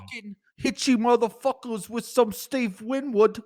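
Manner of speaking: high, put-on English lad accent; brash British bloke, way up high; cheeky Brit voice; mock British accent. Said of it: "giving me shit about my accent".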